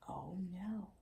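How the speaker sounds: breathy whisper of alarm; whispered dismay. A narrator's reading. Reader gasps to children as bridge breaks, whispered. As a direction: whisper it like the bridge might hear.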